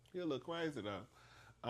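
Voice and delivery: soft retold remark, trailing off; quiet, understated reaction; toned-down aside.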